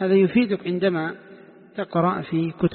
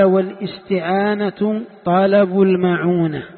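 louder and slow, reading the quoted text; formal text reading; 2 semitones higher, 5 dB louder, slower than usual. Shown as a demonstration. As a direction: recite the author's words slowly and loudly. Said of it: "قال". (قراءة متن بصوت مرتفع وبطء)

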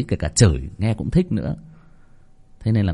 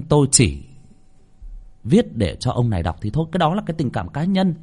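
ordinary talk

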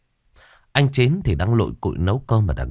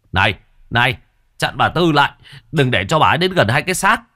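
higher, louder, fast calls; urgent shout; 5 semitones higher, 4 dB louder, faster than usual. A narrator's reading. Villager shouting to stop the mother, urgent yell. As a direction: shout a warning across the crowd. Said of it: "bỗng nhiên la lên".

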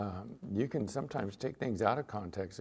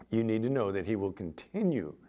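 ordinary delivery

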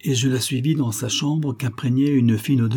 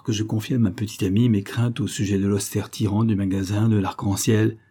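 usual speaking voice

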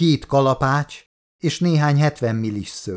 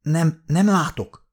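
slow, higher, faltering protest; halting, as if stuck on the words; stammering; 6 semitones higher, slower than usual. A narrator's reading. Nick cannot see without glasses, mildly stammering.

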